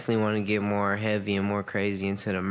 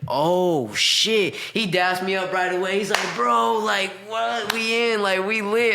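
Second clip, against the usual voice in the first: much higher, louder excited cousin; hyped cousin; 9 semitones higher, 3 dB louder, slower than usual. Retold or imitated. Cousin sees the [name] post, hyped shouting. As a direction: bounce off the walls, he can't believe it. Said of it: "And he's like".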